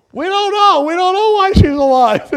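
high, loud, helpless nurses' voice; high mock nurses. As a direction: squeal it like baffled nurses.

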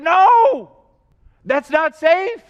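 high, loud, drawn-out no; urgent God. Acted. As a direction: shout the warning like an alarmed parent. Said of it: "And then God comes along, he's like".

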